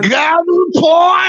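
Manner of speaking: drawn-out, high shouted club chant; slow, high triumphant yell; victory shout. Real, not acted. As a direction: belt it out like a footy chant. Celebrating chant after win.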